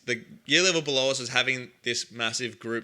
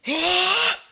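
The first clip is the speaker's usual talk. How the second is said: sudden high yell; loud startled shout up high; 5 dB louder than usual. Retold or imitated. Teacher yells upstairs.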